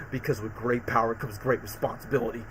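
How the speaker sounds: low, soft, grave lecture; stern hero. Retold or imitated. Peter rebuking the scientist, low and soft. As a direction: deliver the motto with quiet weight. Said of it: "super pissed Peter".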